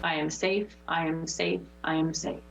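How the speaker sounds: affirmation. Acted. Affirmation chant.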